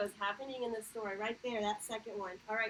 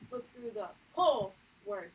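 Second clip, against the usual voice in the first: whole word said strong and louder; emphasis; 7 dB louder than usual. Shown as a demonstration. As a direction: stress every word firmly. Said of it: "We say it strong".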